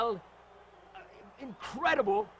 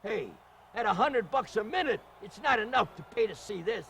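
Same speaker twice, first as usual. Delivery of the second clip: ordinary delivery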